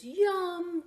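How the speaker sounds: high, loud yum; delighted child. Acted. Little boy tasting cocoa, delighted yum. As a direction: savor it like a happy child.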